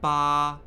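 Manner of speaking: loud "ba" held high, flat and unchanging; steady, level, emphatic "ba"; first tone. Shown as a demonstration. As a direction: hold "ba" on one level note, firmly. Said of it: "high, flat".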